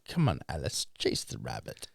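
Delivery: very low, hushed movie-mentor voice; low, quiet mock-mysterious invitation; mock luring. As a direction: coax softly from deep in your register. Mock movie-style lure down rabbit hole.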